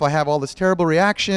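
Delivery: normal talking voice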